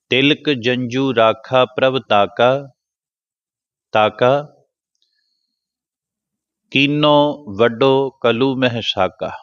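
scripture recitation. Shown as a demonstration.

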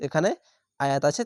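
ordinary delivery

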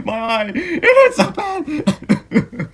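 pain moan. Acted. Moaning from the hurt eye.

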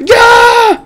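victory whoop; triumphant shout. Real, not acted. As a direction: cheer the win. Triumphant after killing mob.

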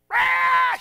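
very loud, high wordless roar; imitated war cry at full blast; mock enraged yell. Old woman yelling at the bus, hammed-up enraged roar. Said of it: "She's yelling at us".

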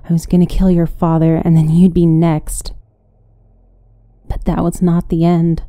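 usual speaking voice

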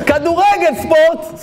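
high sports promo parody; mock advert shout. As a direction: announce it high like a commercial. (חיקוי פרומו ספורט בקול גבוה)

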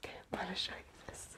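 fast, hushed whisper; quick hushed aside. Real, not acted.

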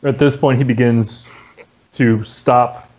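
ordinary delivery

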